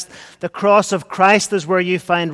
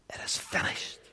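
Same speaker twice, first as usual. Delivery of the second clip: breathed out in a bare whisper; a hushed, voiceless whisper; 16 dB quieter than usual. Acted. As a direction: let the words die away into a whisper. Repeats the last words, whispered.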